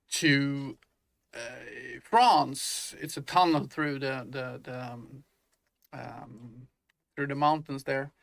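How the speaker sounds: ordinary talk, nothing marked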